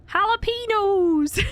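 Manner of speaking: "Jalapenos" drawn out high as "hah-lah-PEE-nohs"; teasing slow "Jalapenos"; mock jalapenos with hard h. Shown as a demonstration. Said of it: "they called them jalapenos".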